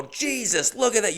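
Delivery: high, startled outburst; astonished exclamation. Retold or imitated. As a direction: exclaim at an unbelievable sight. Acted amazement at running dog.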